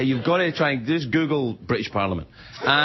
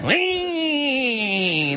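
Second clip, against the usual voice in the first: tiny lawnmower whine; 10 semitones higher than usual. Retold or imitated. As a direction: buzz like a little lawnmower. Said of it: "That's what he sounds like, a little tiny lawnmower".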